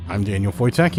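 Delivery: plain reading voice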